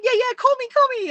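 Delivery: high, loud imitation of eager guests; mock enthusiastic. Would-be guests begging to come on, enthusiastic. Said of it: "everyone's so enthusiastic".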